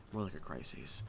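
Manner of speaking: a hint of a brooding Batman growl, kept low. Batman delivers the punchline in the Batcave, low and brooding.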